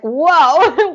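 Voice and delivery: high, loud startled whoa as the horse. Pretend. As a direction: voice the spooked horse jumping. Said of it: "Pinot was like".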